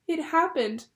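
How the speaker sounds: high, loud frightened outburst; alarmed cry. A narrator's reading. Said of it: "she cried".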